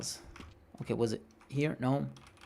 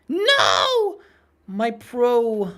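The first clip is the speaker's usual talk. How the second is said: high, loud wail of dismay; anguished cry; 8 semitones higher, 6 dB louder, much slower than usual. Real, not acted. Gamer losing a pro run, anguished cry. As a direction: cry out as if all is lost.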